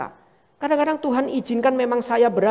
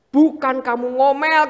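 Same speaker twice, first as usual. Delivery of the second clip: ordinary talk, nothing marked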